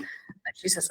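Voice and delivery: usual speaking voice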